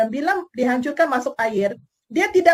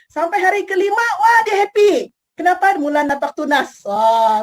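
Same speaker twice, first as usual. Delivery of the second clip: a high, loud joyful build to Wah; excited storytelling; 5 semitones higher, 5 dB louder than usual. A narrator's reading. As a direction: share the child's delight. Child happy carrot sprouts. (bercerita dengan gembira, suara tinggi dan kuat)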